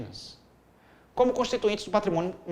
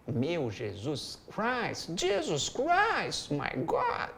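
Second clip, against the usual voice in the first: mock English exclamation; 5 dB quieter, much slower than usual. Real, not acted. Delighted by a strong essay line.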